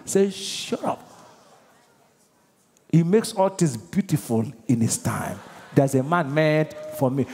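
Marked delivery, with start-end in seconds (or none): 0.3-1.0 hushed, soft dismissal; whispered shut up. Acted. Believer silences Satan's threat with a whispered retort.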